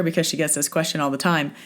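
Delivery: ordinary delivery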